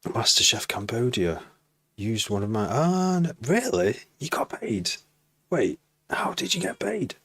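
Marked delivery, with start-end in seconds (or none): 2.7-7.2 high-pitched surprised disbelief; astonished. Real, not acted. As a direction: react amazed, voice leaping way up. Streamer reacts to surprising news.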